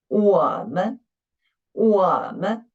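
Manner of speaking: 我们 with a long, dipping 我 and a short, light 们; teacher's model of 我们, stressed on 我 with a neutral 们; first-syllable stress. Shown as a demonstration. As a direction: lean hard on 我 in 我们, toss off 们. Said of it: "make an emphasis of the first syllable".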